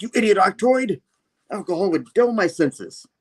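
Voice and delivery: high, hammed-up supervillain rebuke; scolding villain. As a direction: berate a minion grandly. Villain berates his robot.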